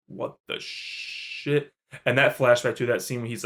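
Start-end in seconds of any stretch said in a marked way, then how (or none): 0.1-1.7 hushed, slow, breathy disbelief; a slow whispered gasp of disbelief; stunned whisper. Retold or imitated. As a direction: barely voice it in shock. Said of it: "and she, I'm like".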